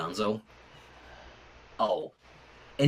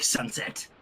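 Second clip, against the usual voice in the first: whispered name; 2 semitones higher, 7 dB quieter than usual. Acted. Calling friend's name, breathless.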